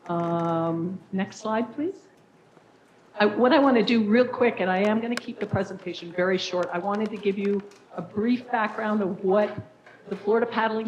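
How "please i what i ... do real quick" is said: ordinary delivery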